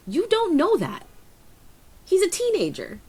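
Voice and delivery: emphatic pushback. Real, not acted. Arguing against the mother.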